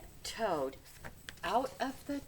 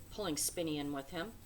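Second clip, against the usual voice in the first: ordinary delivery, nothing marked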